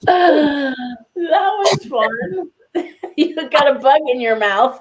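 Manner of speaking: laughing hard while talking; giggling through the words. Real, not acted. Laughing at bug incident.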